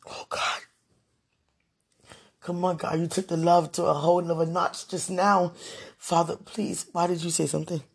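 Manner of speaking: ordinary talk, nothing marked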